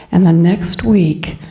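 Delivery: ordinary talk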